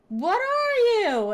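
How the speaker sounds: high, loud, slow demand; startled question. Retold or imitated. Asking the grinning monster what it is, high and loud. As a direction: stretch out a shocked, loud question.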